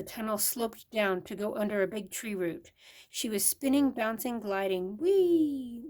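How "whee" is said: high squealing whee; squeaked whee. A narrator's reading. Mole sliding down tunnel, squealing with glee. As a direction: squeal it with delight. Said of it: "Myra squeaked".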